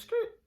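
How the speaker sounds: very high mimicked skid; imitated skateboard screech; skid noise. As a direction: squeal like wheels skidding.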